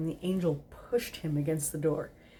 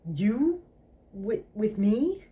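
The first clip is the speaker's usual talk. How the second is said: incredulous; 5 semitones higher, 4 dB louder, much slower than usual. Acted. Incredulous realisation.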